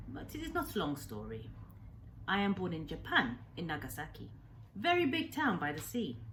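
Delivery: lightly voiced old doctor, proud then grave; old Japanese man telling his past. Old man telling the boy his life story, lightly accented.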